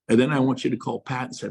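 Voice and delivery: ordinary talk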